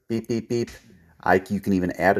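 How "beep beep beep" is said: three quick vocal beeps; whistle beeps. Shown as a demonstration. Trainer showing the recall whistle, three vocal beeps. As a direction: chirp out three whistle blasts. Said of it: "beep with your whistle".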